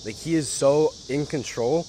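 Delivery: usual speaking voice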